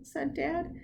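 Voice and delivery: ordinary delivery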